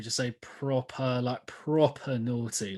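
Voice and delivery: "proper naughty" said slow, British style; teaching "proper naughty" slowly. Shown as a demonstration. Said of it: "just make sure you say proper".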